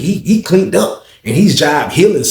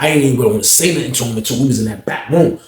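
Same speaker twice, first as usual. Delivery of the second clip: ordinary delivery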